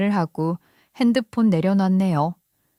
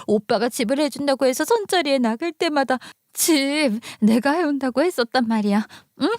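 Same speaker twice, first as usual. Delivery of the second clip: higher, whining sister-in-law; tearful pleading; 4 semitones higher than usual. Acted. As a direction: beg as if close to tears. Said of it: "갑자기 막 울먹이기 시작하더라고요". (울먹이며 높은 목소리로 매달리듯)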